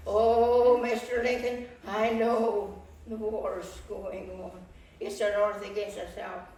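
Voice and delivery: loud, upset protest, slow on the war line; agitated outburst. Acted. Wife protesting loudly to her husband, upset. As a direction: burst out at him, pleading and worked up. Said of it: "please calm down".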